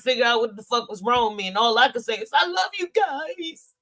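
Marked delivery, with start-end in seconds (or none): 2.3-3.7 high, drawn-out tearful wail; high mock-blubbering, stretched out; retold crying. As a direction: sob it slowly, imitating yourself. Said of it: "cried on the phone to them".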